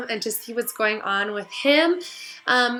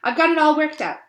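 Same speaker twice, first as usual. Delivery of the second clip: smooth; 5 semitones higher, 5 dB louder than usual. Acted. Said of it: "she went on smoothly".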